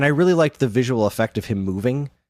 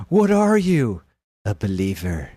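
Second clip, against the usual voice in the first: mock movie villain; 3 semitones lower than usual. Quoting movie line mockingly.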